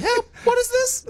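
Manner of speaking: small, very high falsetto plea; imitated girlfriend frozen on the spot; stuck, frozen girlfriend. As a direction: squeak it as if afraid to move. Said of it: "one of those voice where you're stuck".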